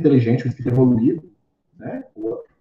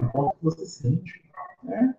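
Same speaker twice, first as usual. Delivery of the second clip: ordinary talk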